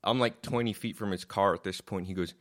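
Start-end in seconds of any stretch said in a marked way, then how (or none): none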